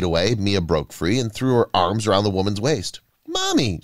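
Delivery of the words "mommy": very high-pitched squeal of recognition; small girl's high, excited call; child's joyful cry. A narrator's reading. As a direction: cry out to a long-missed mother. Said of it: "she cried".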